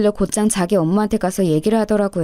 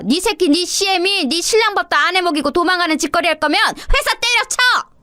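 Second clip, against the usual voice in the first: put-on high, furious scolding old woman; rising fury to a high shrieking end; raging mother-in-law; 4 semitones higher than usual. Mother-in-law raging at daughter-in-law for leaving without cooking.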